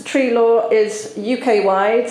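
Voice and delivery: ordinary talk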